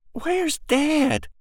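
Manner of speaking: put-on a small girl pressing her question; little girl. Girl asks about father.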